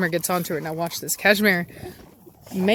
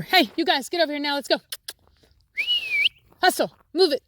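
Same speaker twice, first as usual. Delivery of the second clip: calling out; 9 semitones higher, faster than usual. Real, not acted. Calling dogs over.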